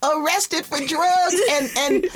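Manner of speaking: high, squealing words through real laughter; helpless laughter. Real, not acted. Co-host teasing sister about her face, cracking up. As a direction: barely get it out for laughing. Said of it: "I am laughing".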